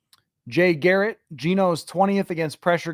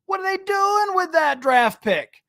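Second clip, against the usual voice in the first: mock outrage; 12 semitones higher than usual. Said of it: "We like to go nuts".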